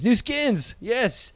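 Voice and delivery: giddy gamer squeal; gamer excitement. Retold or imitated. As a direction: cheer like a gamer getting new skins. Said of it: "It's like video gamers".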